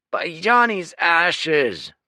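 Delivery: loud, high, slow exasperated oath; exasperated outburst. A narrator's reading. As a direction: throw up your hands and curse. Lieutenant exasperated.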